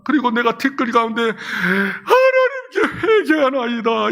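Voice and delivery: slow, choked prayer of repentance; repenting before God in tears, slow and choked; tearful repentance. Acted. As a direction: plead with God through tears, slowly.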